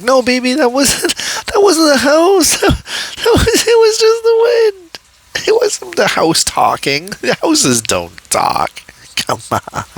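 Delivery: high, sugary sweet-talk through giggles; sweetly cooing to her lover. Retold or imitated. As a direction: coo at him like a baby. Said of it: "talking with him in a sweet kind of voice".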